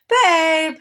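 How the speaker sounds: sharp summons across to a partner; calling out. Real, not acted. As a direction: call out for your partner's attention.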